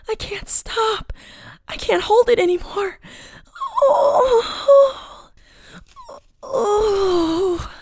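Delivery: high, strained, breaking plea; desperate wailing. Acted. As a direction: cry out helplessly between gasped words and long moans. Captive in distress, losing control.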